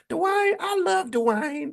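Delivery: very high, slow, drawn-out put-on voice; exaggerated imitation of a sitcom character; Whitley Gilbert. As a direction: stretch it out high, hammed-up and lovestruck. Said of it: "remember how she talked".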